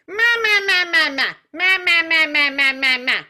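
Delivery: loud, high nasal quacking ma; super nasal. Shown as a demonstration. Teacher shows the class a super nasal quack. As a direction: push every ma up into the nose. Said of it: "It's super nasal".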